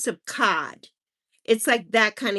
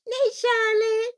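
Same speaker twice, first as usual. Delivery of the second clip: sing-song greeting; 11 semitones higher, slower than usual. Real, not acted.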